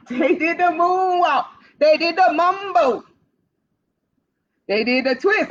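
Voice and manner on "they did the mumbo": ordinary delivery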